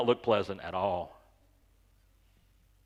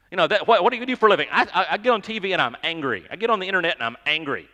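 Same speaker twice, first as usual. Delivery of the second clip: mock angry influencer; 5 semitones higher, 8 dB louder, faster than usual. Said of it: "they look like they're mad about it".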